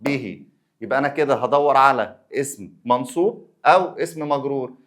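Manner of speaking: ordinary talk, nothing marked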